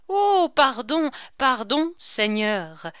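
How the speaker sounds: high, loud mock-pleading; lightly nasal, pleading like the king; nasal imitation of king. A narrator's reading. Chicot poses as the king begging the Lord, nasal pleading. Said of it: "dit Chicot en nasillant comme le roi".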